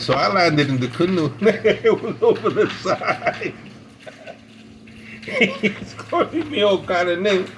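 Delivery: words broken up by laughter; cracking up mid-story; laughing while telling. Real, not acted. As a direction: tell it through real laughs.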